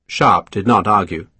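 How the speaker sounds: plain reading voice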